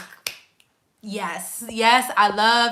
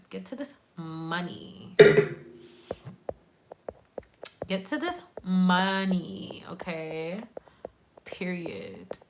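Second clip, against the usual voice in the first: slow, whispered catchphrase; very soft, low and confiding; hushed; 4 semitones lower, 6 dB quieter, slower than usual. Real, not acted. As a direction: drop to a drawn-out whisper. Hushed money catchphrase to viewers.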